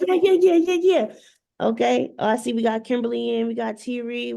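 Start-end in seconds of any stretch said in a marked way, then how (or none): 0.1-1.1 excited. Retold or imitated. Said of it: "I'm like".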